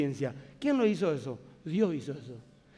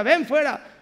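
louder, higher ven fuera; commanding call; 6 semitones higher, 7 dB louder, slower than usual. Retold or imitated. Jesus commanding Lazarus out of the tomb, loud. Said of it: "tuvo que ser específico".

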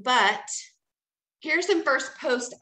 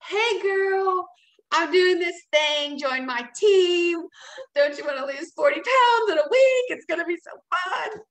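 much higher, louder, hammed-up sales pitch to a stranger; mock pushy recruiter; 6 semitones higher, 5 dB louder than usual. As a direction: parody the spammy hey-girl recruiter, slow and overbright. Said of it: "It's like".

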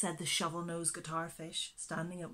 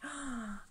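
a small delighted gasp; soft gasp; 5 semitones higher, 5 dB quieter than usual. Real, not acted. Reader to children after the story, a soft gasp.

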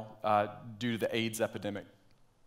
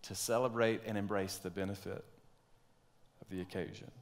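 much softer, almost to himself; softer repeat; 2 semitones lower, 9 dB quieter than usual. Shown as a demonstration. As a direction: let it sink in, quietly. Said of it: "but rather to rejoice and to embrace".

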